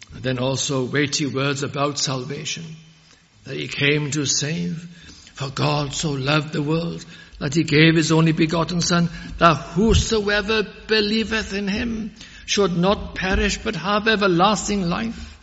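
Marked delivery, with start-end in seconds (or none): 5.3-15.4 raised, rising sermon proclamation; building pulpit recital; ringing scripture recital. Acted. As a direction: lift the verse up as you preach.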